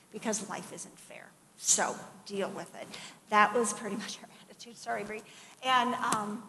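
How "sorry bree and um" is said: ordinary delivery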